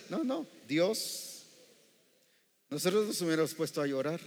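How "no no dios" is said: ordinary talk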